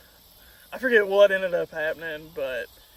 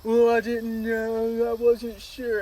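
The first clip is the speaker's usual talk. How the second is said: high, slow whiny excuse; mocking girlfriend; 4 semitones higher, slower than usual. Imitating his girlfriend's excuse, mock whine. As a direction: imitate someone dithering, drawn out and mocking.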